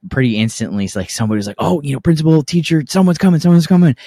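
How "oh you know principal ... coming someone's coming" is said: high, alarmed lookout imitation; urgent warning. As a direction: warn the fighters, acting out the alarm. Retold warning that a teacher is coming.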